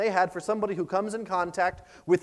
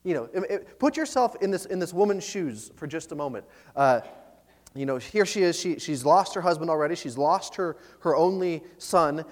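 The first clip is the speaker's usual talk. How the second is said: ordinary talk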